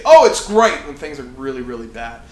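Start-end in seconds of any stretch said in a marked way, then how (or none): 0.0-0.9 high, loud mock cheer; fake cheerful. Preacher mocking a leader's fake answer, loud cheer. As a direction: pretend all is fine, brightly. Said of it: "Don't lie and say".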